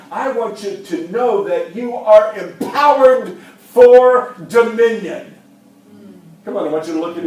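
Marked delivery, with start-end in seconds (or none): 0.1-5.3 slow emphatic Paul. Retold or imitated. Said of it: "he is saying".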